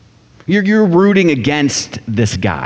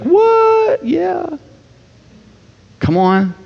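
much higher, a squeaky shocked "What?" then pleased; stunned disbelief; much slower than usual. Pretend. Pastor imagining his debt cancelled, high stunned delight. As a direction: react like someone just told their debt is gone. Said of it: "How many of you would be like".

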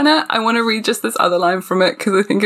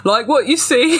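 higher cheeky British character voice; British accent; 5 semitones higher than usual. Acted. Reader voicing the novel's smirking stranger, put-on British accent. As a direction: ham up a flirty British stranger. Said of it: "I need to do British".

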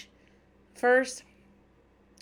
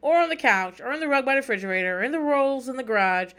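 higher, louder, fast run of places; eager listing; 5 semitones higher, 6 dB louder, faster than usual. Retold or imitated. R J listing where the dog slept at homegoing, eager and fast. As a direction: rattle off every spot like an eager kid. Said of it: "R J's like".

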